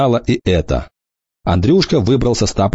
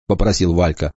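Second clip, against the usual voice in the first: ordinary delivery, nothing marked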